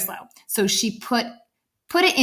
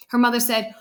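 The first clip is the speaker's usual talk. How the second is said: ordinary delivery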